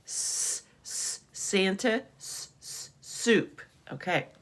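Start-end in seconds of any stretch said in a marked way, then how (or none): 0.1-0.6 soft hissed S sound. Shown as a demonstration. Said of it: "starts with an S". 2.2-2.9 very soft hissed S, S; whispered S hiss. Shown as a demonstration. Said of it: "starts with an S".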